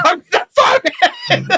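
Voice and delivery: high-pitched ranting, cracking into laughter; imitated road rage. Teller mimics friend threatening out the window, imitated road rage. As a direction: rave like an enraged driver, half laughing.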